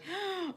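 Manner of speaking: high-pitched gasp of mock delight; mock excited gasp. Imitating people crazy for chocolate, mock excited gasp. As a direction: gasp like an overexcited chocolate lover.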